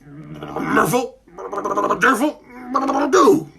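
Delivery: mumbled dragon through sealed lips; a mumbling dragon with a gummed-up mouth; mouth stuck with peanut butter. Acted. The dragon trying to speak through a peanut-butter-sealed mouth. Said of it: "deep peanut buttery dragon voice".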